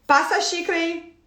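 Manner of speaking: put-on boyfriend quoting her bossy tone back; a hint of a curt command; imitating her order; mock bossy order. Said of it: "Tipo, uma ordem".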